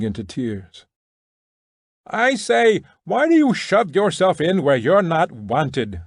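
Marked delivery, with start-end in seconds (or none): 2.0-6.0 higher, shoving protest from the crowd; shouted. A narrator's reading. Prisoner shouting at a man shoving in, higher. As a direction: shout it at a pushy stranger. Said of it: "someone shouted".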